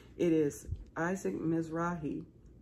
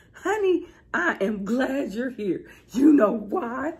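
booming sing song welcome; 7 semitones higher, 8 dB louder, slower than usual. Real, not acted. Excited hype to viewers.

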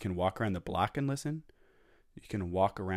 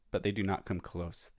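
ordinary delivery, nothing marked

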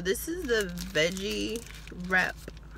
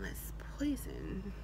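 whispering it slowly; breathy, secretive whisper; 10 dB quieter, slower than usual. Pretend. Chant repeated as a whisper.

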